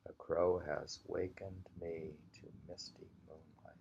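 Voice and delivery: normal talking voice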